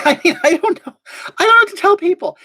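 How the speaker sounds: high, laughing through the words; laughing, a little embarrassed. Real, not acted. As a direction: giggle it out, high and loud. Laughing about follower counts.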